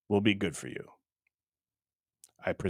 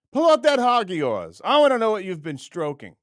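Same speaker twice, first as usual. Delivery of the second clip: much higher, louder taunting call; mock macho challenge again; 7 semitones higher, 4 dB louder than usual. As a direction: shout the dare in a mock voice. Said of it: "You gotta know what to do with it".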